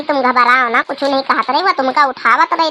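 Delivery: normal talking voice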